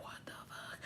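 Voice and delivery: breathless whispered disbelief; shocked whisper. Retold or imitated.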